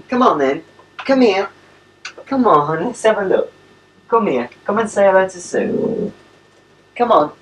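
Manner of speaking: high-pitched pet-calling voice; bright little calls to a pet; coaxing a dog. Real, not acted.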